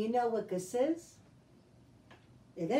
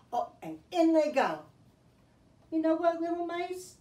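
usual speaking voice

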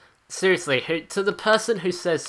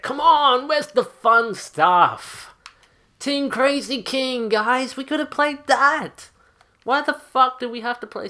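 higher, slow pleading complaint; whiny excitement; 10 semitones higher, 5 dB louder, slower than usual. Real, not acted. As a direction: beg the team for the fun mode. Complaining about missed mode.

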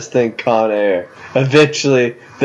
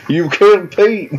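accusing townsfolk; 11 semitones higher than usual. Pretend. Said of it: "Just waiting for the entire town to come out".

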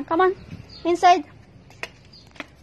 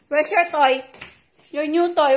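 ordinary talk, nothing marked

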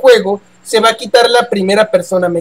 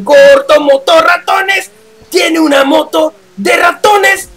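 cartoon shout; 7 semitones higher, slower than usual.

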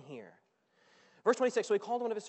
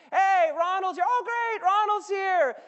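high, loud call over the music; party shout; 10 semitones higher, 14 dB louder, slower than usual. Acted. Servant calling to the dad over the dancing.

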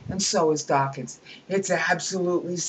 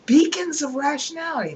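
much higher, sneering grandeur; mocking pompous quote; 9 semitones higher, 4 dB louder than usual. Lecturer ridiculing a scientist's boast, high and sneering.

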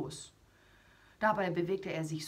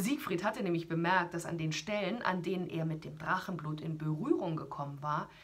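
plain reading voice

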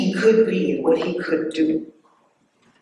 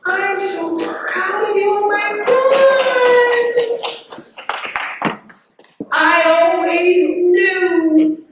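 high, slow mock-gushing teacher voice; mocking proud teacher; 13 semitones higher, 5 dB louder, slower than usual. Speaker mocks teachers who now praise her, high and gushing. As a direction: ham up a gushing teacher, pitched high and drawn out. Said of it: "same teaching guy tell me".

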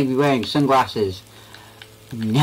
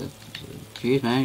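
ordinary delivery

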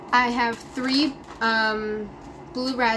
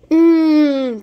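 long, loud, delighted mmm; loud savoring mmm; 5 semitones higher, 13 dB louder than usual. Real, not acted. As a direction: relish the taste out loud. Tasting candy.

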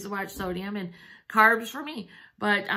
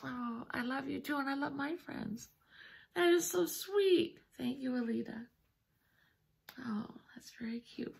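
soft, moved murmur of affection; tearing up; 7 dB quieter than usual. Real, not acted. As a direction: speak softly, on the edge of tears. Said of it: "I'm already tearing up".

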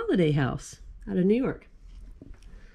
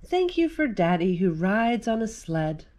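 gentle read-aloud of a rhyming picture book; reading to children. Acted. Mom reading a Thanksgiving book to kids, gentle read-aloud.

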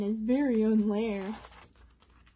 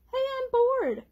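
higher, louder pleading duck; eager duck voice; 13 semitones higher, 4 dB louder than usual. Acted. Duck calling bear to open up, eager and high. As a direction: call through the door like a pesky duck.

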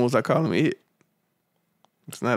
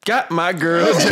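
laughing greeting; 5 semitones higher than usual. Real, not acted.

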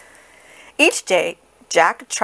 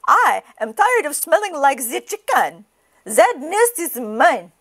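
put-on higher duck with French accent; indignant duck; 7 semitones higher than usual. A narrator's reading. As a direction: complain high, as a fed-up French duck. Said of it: "cried the duck".

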